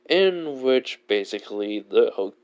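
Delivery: ordinary delivery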